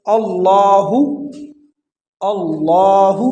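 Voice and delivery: اللہ with a full, dark double L; heavy Allah. Shown as a demonstration. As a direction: slowly recite اللہ with a rounded heavy sound. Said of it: "لفظ اللہ جو ہے یہ پر پڑھا جائے گا". (اللہ کو پُر پڑھیں)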